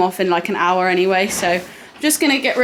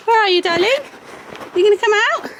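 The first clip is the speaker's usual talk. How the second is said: high pet-talk voice; 10 semitones higher, much faster than usual. Real, not acted. Calling horse in stable.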